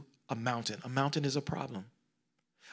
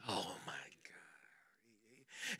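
awed whisper; 7 semitones lower, 18 dB quieter, much slower than usual. Real, not acted.

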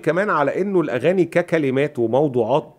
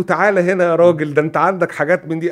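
eager retold summons; 5 semitones higher, 6 dB louder than usual. Composer eagerly summons lyricist, retold.

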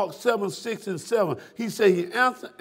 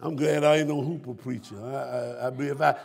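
normal talking voice

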